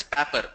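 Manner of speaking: "paper" with a short flat A, like "papper"; regional pronunciation. Shown as a demonstration. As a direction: say "paper" with the A of "apple". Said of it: "They pronounce that as paper".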